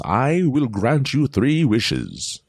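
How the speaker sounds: a genie who could put you to sleep; sleepy genie voice. Acted. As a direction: grant the wishes slowly, like a lullaby. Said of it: "In that voice, in my voice, because, you know, it puts you to sleep".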